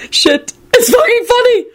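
high-pitched, helpless hilarity; gasped out. A narrator's reading. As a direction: gasp it, overcome by the joke. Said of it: "George gasped out".

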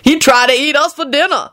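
high-pitched disdainful jibe; sniffy. A narrator's reading. Lucretia claiming he'd eat them, sniffy. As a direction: scoff in a high character voice. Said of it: "Lucretia sniffed".

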